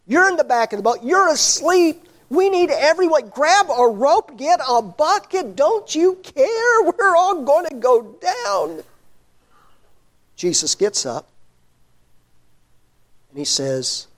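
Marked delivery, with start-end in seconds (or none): 0.1-8.8 much higher, slow, desperate urging; frantic pleading. Retold or imitated. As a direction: rouse him, fearing they'll sink. Disciples pleading with sleeping Jesus.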